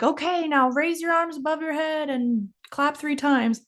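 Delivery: high-pitched mock guard's orders; mock security guard. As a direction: order passengers about in a put-on voice. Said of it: "then somebody's like".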